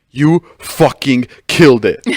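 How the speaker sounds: slow, emphatic retold congratulations; deliberate, weighty mock thrill; imitating excited boss. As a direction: punch each word out with a gap. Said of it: "He was so excited".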